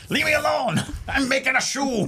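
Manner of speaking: high, grumpy cartoon Irish leprechaun; cranky leprechaun in Irish accent. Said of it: "forgive my, uh, Irish accent".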